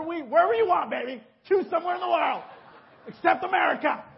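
high, loud teasing wife, building to a shout; playful wife voice. Retold or imitated. Wife tells him to pick anywhere, high teasing shout. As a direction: ham up his wife's sweet offer, then shout the catch. Voicing wife's playful offer.

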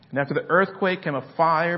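loud, building preacher reading of scripture; sermon reading with rising force; preaching. Acted. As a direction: proclaim the storm over the crowd.

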